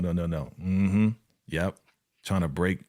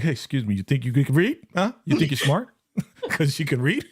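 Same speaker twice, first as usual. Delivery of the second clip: mocking sneer; 4 semitones higher than usual. Mocking the question again, laughing.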